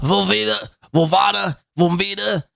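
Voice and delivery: cycling Velveeta, Volvada, Volvita, each vowel sliding further off; mocking mispronunciation. Mocking Chad's clip, he mangles Velveeta into Volvada. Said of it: "It really ain't that difficult to say the words Velveeta".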